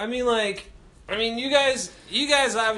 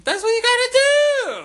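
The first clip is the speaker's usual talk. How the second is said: much higher, louder sassy preach; sassy diva voice; 6 dB louder than usual. Acted. Host telling cohost to confess on the wings, high sassy voice.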